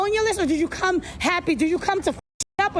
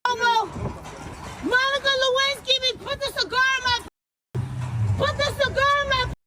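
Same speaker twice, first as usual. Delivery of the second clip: higher, sing-song taunting calls; mocking call-out; 5 semitones higher than usual. Interviewer heckling Cuomo from the street, higher taunting calls. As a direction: heckle in a raised chant.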